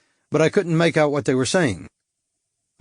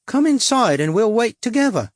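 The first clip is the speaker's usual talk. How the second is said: higher, coy cockney come-on; cockney prostitute; 9 semitones higher than usual. A narrator's reading. Suzette luring the stranger, coy cockney come-on. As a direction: tease him in, sweet and brassy.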